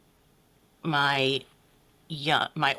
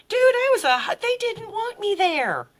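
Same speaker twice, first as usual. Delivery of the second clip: much higher and louder, bursting out; emphatic outburst; 5 dB louder than usual. Real, not acted. Guest to host about being unwanted at home, a loud high outburst. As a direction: blurt it out, almost shouting. Exclaiming they wanted her gone.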